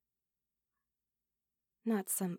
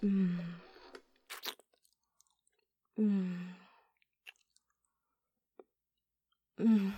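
muffled moan; 6 dB louder, slower than usual. Acted. Moaning during oral sex scene.

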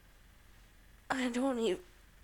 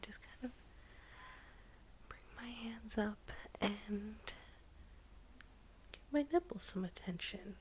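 whispering; 11 dB quieter than usual. Real, not acted. Whispered run.

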